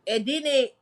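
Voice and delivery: normal talking voice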